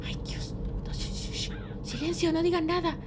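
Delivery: soft, breath-only shushing; anxious hushing. Pretend. Child mistaken for a thief, hushed. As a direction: hush everyone before you get caught.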